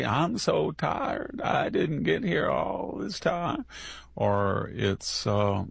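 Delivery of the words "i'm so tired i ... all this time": slow, higher, drawn-out mocking drawl; sarcastic, very slow put-on drawl; mock Hillary drawl. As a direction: stretch every word like a sneering Hillary impression.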